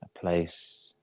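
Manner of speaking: guided meditation. Real, not acted. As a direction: ease them into rest, unhurried and even. Leads a yoga nidra relaxation.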